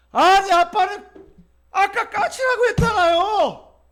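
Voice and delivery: high, loud, slow furious son yelling; angry shout. Retold or imitated. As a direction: blow up at the confused father. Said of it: "막 화를 내는 거야".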